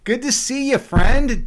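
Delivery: loud, high-pitched happy welcome; excited greeting. Real, not acted. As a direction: burst out hailing a friend who just arrived. Greets newly arrived viewer.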